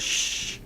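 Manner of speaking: soft unvoiced shh; shushing. Hidden voice behind counter shushing, unvoiced. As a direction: hush them sharply. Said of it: "somebody says".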